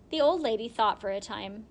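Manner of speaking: ordinary delivery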